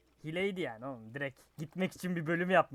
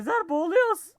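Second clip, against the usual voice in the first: high, loud panicked call; sudden high alarm; alarmed cry; 12 semitones higher, 8 dB louder than usual. Real, not acted. Drowning alarm in game.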